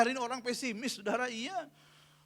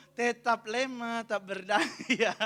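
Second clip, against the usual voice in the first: very slow mock-helpless moan; meniru keluhan lemah; much slower than usual. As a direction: drag out the feeble complaint, close to laughing. Keluhan ibu untuk ketiga kali. (keluhan lesu yang dilebih-lebihkan)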